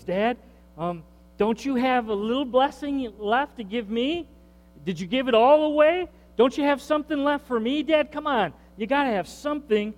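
hammed-up desperate son; pleading son. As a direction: wheedle the father for scraps. Esau begging his father.

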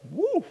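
high, drawn-out "woo"; retold woo. Preacher recalling his reaction to his own prayer, high woo. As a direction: let out a high-pitched woo of hesitation. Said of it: "and I was like".

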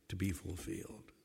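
low and very soft to be fulfilled; soft close. Acted. Preacher ending the thought quietly. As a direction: fade it out gently.

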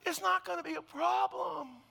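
high, loud, drawn-out mock shout; hammed-up shout across a park; exasperated yell. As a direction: yell it out slowly, stretching the words. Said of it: "I wanna yell out".

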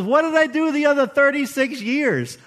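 self-reproaching question. Retold or imitated. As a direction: ask it as if you can't believe it. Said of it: "the question I ask myself is".

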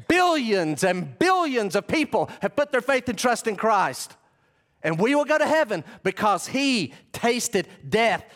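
loud, high-pitched pulpit proclamation; impassioned preaching. Acted. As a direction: preach at full force, drawing out key words. Preaches at full force.

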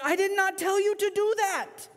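high-pitched, protesting voice of God; exasperated God. Acted. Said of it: "God's up there going".

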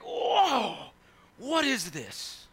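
high whoa sinking into a slow stunned question; awed shepherd. Retold or imitated. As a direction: gasp at the sight, then ask slowly. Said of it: "It's like".

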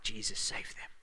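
a quiet breathy plea; hushed plea. Acted. Pastor voices Jesus praying from the cross, whispered. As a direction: whisper the prayer softly.

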